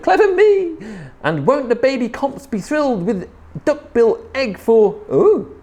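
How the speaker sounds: high, delighted little dinosaur voice; gleeful self-congratulation. A narrator's reading. Little Comp pleased with her stolen egg, high glee. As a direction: gloat in a high excited voice. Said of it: "and murmured".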